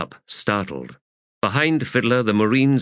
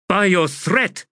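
high, loud snap; snapped; 11 semitones higher, 4 dB louder than usual. A narrator's reading. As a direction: cut him off sharply. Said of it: "snapped the Adjunct".

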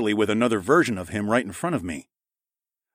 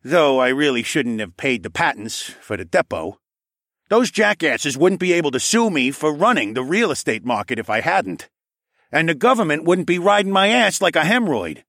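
put-on brash old man voice; 7 semitones higher, 5 dB louder than usual. A narrator's reading. Saul voiced in narration.

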